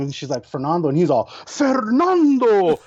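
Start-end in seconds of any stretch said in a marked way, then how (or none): 1.5-2.8 high, slow, savoring the name; host relishing the name, stretched long; drawn-out emcee. Retold or imitated. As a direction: roll the name out like a showman. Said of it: "the way he said it".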